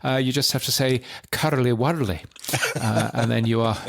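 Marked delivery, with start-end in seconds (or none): 1.3-2.2 mock Scottish with rolled r's; comic Scots catchphrase; Scottish accent. Shown as a demonstration. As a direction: demonstrate a random Scottish accent. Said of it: "just random Scottish accent".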